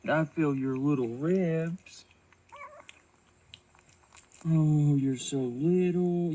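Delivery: tender. Real, not acted. Tender with the kitten.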